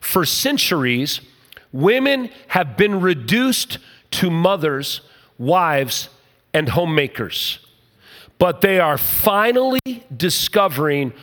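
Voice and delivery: slow, weighty reading, each word set apart; slow deliberate reading. Shown as a demonstration. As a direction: read it slowly so every word lands. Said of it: "I want you to listen to the words".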